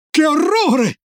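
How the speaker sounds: put-on very high-pitched child's exclamation; high, appalled outcry; horrified cry. A narrator's reading. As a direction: cry out in horror without much volume.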